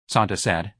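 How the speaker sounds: ordinary delivery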